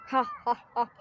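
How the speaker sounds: mock villain cackle, ha ha ha; a hammed-up mustache twirling villain laugh; evil villain laugh. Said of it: "ضحكة الشرير يعني".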